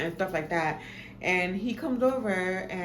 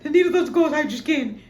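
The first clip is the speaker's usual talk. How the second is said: whiny protest; 9 semitones higher, 8 dB louder, faster than usual. Retold or imitated. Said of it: "he was like".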